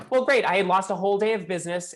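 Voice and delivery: sarcastic producer griping about a lost day; sarcastic grumble. Pretend. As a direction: grumble it like a put-out host. Ungracious reaction contrasted with gracious.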